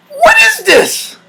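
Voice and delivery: very high, slow, strained cry; mock horrified wail. Reacting to two minutes, high mock wail. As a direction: shriek it slowly in disbelief.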